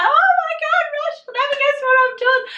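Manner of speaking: very high, loud, rapid squeal; bursting with excitement. Retold or imitated. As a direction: re-enact bursting in with big news. Retells telling news at home.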